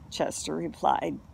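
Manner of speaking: usual speaking voice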